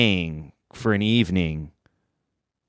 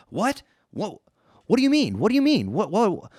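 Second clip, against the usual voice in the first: confused panic; 7 semitones higher than usual. Retold or imitated. Groggy confused reply.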